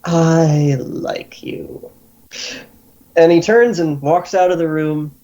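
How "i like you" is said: very slow, whispery drawl; grinning Guildmaster. Acted. Guildmaster studies the captive then approves, slow whispery grin. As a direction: stretch it out in an airy near whisper. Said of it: "and just grins".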